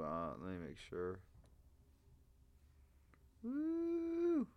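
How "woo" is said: high, loud joyful whoop; excited whoop. Real, not acted. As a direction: let out a sudden high woo. Excited whoop mid-reading of donors.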